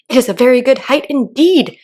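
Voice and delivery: high, loud, offended retort; angry. A narrator's reading. Caterpillar defending its height to Alice, angry. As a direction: snap back, offended. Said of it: "said the caterpillar angrily".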